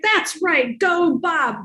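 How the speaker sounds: root for him like a fan; cheering. Retold or imitated. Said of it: "And I was like".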